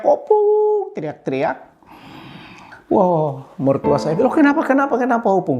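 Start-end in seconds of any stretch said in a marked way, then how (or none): 0.0-0.9 put-on very high, drawn-out child's call. Child arriving at the grandparent's house, high drawn-out yell. 4.3-5.6 high, rapid repeated questioning; fussing grandparent, pitched high; fussing concern. Retold or imitated. Grandparent greeting the upset grandchild, high fussing concern.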